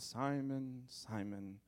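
soft, fading inward name call; quiet inner voice. Retold or imitated. Jesus thinking to Peter, quiet and soft. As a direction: say it as if only thinking it. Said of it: "probably in his mind".

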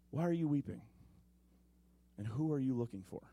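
soft, low, gentle question; tender Jesus. Acted. Jesus gently asking the weeping Mary, soft and low. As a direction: ask as if you already know her grief.

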